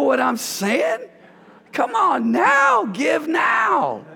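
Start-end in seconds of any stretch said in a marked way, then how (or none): 1.7-4.1 high, slow, stretched-out shout; a rising preacher's cry of come on now. Real, not acted. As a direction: call it out to the whole room.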